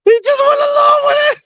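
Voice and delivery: high, cracking with laughter; laughing while talking. Real, not acted. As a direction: barely get it out for laughing. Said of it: "laughing hard while telling".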